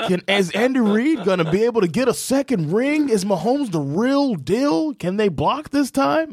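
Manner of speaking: hammed-up high-pitched commentator; hyped media storylines. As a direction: pose each question like overblown sports hype. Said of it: "all of the storylines".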